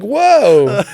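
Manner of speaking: mock alarmed whoa. Retelling his retreat from her, in mock alarm. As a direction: put the brakes on with a hammed-up whoa. Acted startled reaction while backpedaling.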